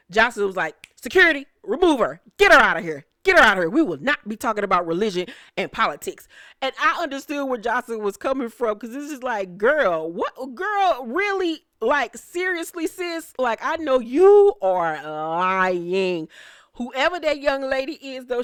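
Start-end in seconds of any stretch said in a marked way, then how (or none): 1.0-6.3 loud, brisk mimicked command to security; retold order. Acts Jocelyn ordering the girl out. 9.6-16.3 high incredulous questioning, loud slow finish; mock disbelief. Voices disbelief at Jesus Girl.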